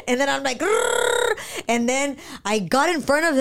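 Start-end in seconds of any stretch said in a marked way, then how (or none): 0.6-1.3 high playful engine growl; imitated angry rumble; mock growl. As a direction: growl like a revving truck. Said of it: "lost my mind".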